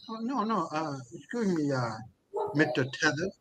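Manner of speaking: calm reply. Retold or imitated. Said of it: "calmly said".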